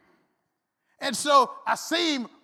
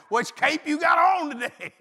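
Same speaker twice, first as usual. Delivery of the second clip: louder, higher, laughing jab; laughing tease; 4 semitones higher, 7 dB louder than usual. Retold or imitated. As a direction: mimic his wife teasing him. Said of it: "you know, 'Well".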